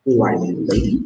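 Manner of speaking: ordinary talk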